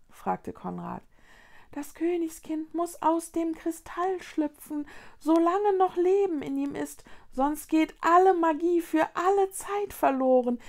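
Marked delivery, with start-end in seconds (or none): 1.7-10.6 high little pleading voice; urgent Luni creature. A narrator's reading. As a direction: urge them on, slowing on the last plea.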